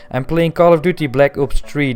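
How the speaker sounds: usual speaking voice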